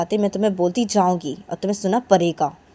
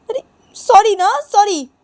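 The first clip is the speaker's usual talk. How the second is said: high, slow whiny apology; high pleading voice; pleading sorry; 13 semitones higher, 10 dB louder, slower than usual. Acted. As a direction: drag the sorry out, begging.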